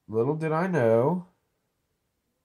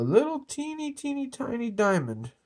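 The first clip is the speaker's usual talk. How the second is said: put-on teeny-tiny voice; 11 semitones higher than usual. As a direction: go way up high for something minuscule. Shows off a minuscule diamond playfully.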